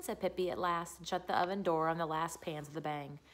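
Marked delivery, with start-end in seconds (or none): none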